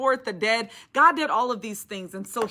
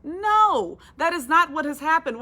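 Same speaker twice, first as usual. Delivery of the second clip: emphatic no; 6 semitones higher, 6 dB louder than usual. Real, not acted. Preacher answers the doubting questions.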